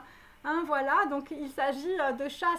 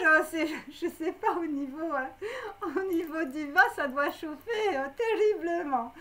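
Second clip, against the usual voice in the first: laughing through the words; real laughter, slowed on ça doit chauffer; giggling. Real, not acted. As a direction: break into giggles at the cheeky reading. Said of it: "je sens un truc assez marrant".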